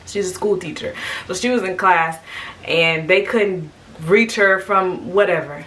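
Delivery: normal talking voice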